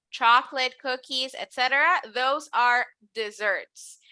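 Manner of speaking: usual speaking voice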